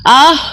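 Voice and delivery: sharp loud cry of pain. Acted. Woman falling from the roof, pained cry. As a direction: cry out as she falls.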